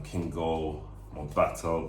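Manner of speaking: usual speaking voice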